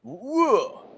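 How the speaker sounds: high, surprised whoa; a sudden startled yelp. Retold or imitated. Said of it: "and my arm just went".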